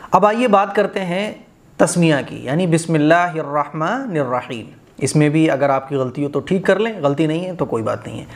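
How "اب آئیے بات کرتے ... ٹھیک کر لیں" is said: ordinary talk, nothing marked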